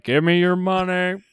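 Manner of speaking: slow bullhorn demand. Retold or imitated. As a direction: bark it through a megaphone. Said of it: "my bullhorn impression".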